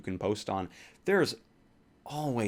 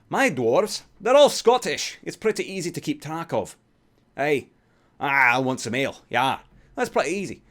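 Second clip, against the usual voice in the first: loud, much higher hammed-up Scottish dwarf; Scottish dwarf accent; 5 semitones higher, 8 dB louder than usual. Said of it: "He does his D and Ds like this".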